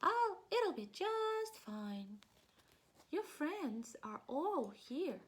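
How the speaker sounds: high, slow gentle mouse; high tender mouse, not a real whisper; comforting mouse. Acted. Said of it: "He whispers in his ear".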